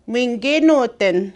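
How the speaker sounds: slow, careful teaching pronunciation; slow demo. Shown as a demonstration. Teacher modelling the phrase slowly for students. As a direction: spell it out slowly for learners. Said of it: "And again, you can say".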